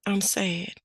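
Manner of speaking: put-on small whispered toddler voice; sad toddler whisper. Toddler whispers to grandma that he's sad. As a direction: whisper it like a sad little boy.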